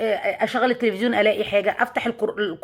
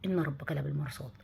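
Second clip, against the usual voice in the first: much softer, a near-whisper to herself; hushed, as if in her ear; 5 semitones lower, 11 dB quieter than usual. Retold or imitated. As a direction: murmur it like a voice inside your ear. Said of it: "كأن حاجة بتقول لي في ودني". (همس خافت كأنه في الودن)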